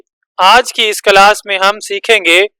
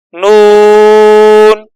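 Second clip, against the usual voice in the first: slow, stretched letter name; drawn-out letter name; 4 dB louder, much slower than usual. Shown as a demonstration. Teacher drawing out the letter name for students. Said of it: "ہجے کرتے جائیں گے".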